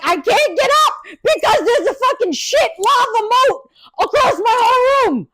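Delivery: high, shouted complaint; mock yell. Stuck in bed by the mess, high mock shout. Retelling her own panicked complaint.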